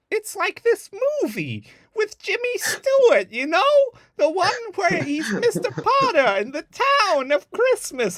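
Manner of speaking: squeaky hammed-up confused sister; clueless Jill. Sister muddles the Jimmy Stewart film, squeaky ditzy voice. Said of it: "Jill compares something like".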